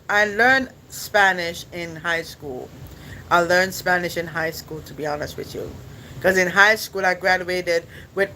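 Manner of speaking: ordinary delivery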